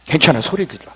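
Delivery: ordinary talk, nothing marked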